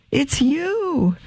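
high, soft and breathless with joy; overjoyed. Acted. Mother greeting her returned son, high joyful. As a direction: she can hardly believe it.

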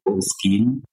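Boleskine said as BOLE-skeen; variant pronunciation. Shown as a demonstration. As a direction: say Boleskine with a long "ee" ending, like "bowl-skeen". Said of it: "some people say Boleskine".

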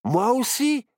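high, loud, jokey character voice; loud, high-pitched delight; amused bear cub. A narrator's reading. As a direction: crack a joke with glee. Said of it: "rigola Barnabé Ourson".

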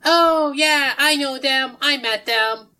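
high, loud character voice; calling back. Acted. Sushi Kid answering departing travellers, loud chipper call. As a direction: holler it back cheerfully from a distance.

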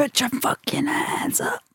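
high, breathless whisper of a crowd chant; whispered hype line. Pretend.

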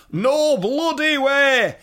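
high, loud, drawn-out wail of refusal; slow, high-pitched shout of mock horror; outraged refusal. British people refusing to chat, imitated.

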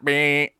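loud, high buzzer beep. Pretend. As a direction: blurt it like a game-show buzzer. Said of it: "I need that in a buzzer".

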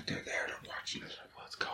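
whispering. Pretend. Spooky aside about live viewers.

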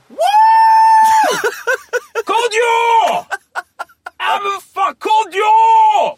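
put-on high, loud drunken cheer of a name; drunk fan yelling. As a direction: bellow it like a hammered fan at a gig. Drunk fan shouting comedian's name.

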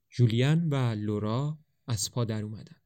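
plain reading voice

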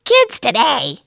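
high, disapproving mom tone; mother's voice. Retold or imitated. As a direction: grumble it like your disapproving mother. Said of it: "I sound like my mother".

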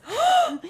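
sharp high gasp; surprised gasp. Real, not acted. Sees the gift Bible.

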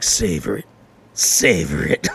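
deep, slow savoring. Acted. Man coaxing himself in the shower, deep and slow. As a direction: savor the moment, low and drawn out.

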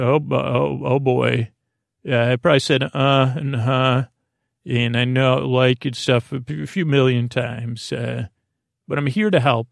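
ordinary talk, nothing marked

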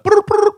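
very high rolled brrr twice; mock double ring, high; double ring imitation. Shown as a demonstration. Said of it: "if it goes ring".

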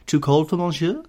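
valet's question. A narrator's reading. Valet checking on his master's comfort, lightly voiced question. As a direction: ask as an attentive servant, voice lifted. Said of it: "asked his valet".

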